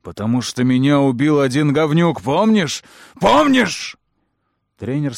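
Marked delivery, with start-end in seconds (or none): 0.0-4.0 louder to a high screaming Помнишь; building furious shout. A narrator's reading. Coach rages at Chet.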